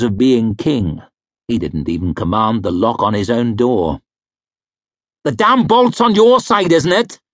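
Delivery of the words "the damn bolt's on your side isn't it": sharp, higher, louder snap; snapped. A narrator's reading. Husband snapping at his wife about the locked door, sharp snap. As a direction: bark it at her, irritated. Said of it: "he snapped".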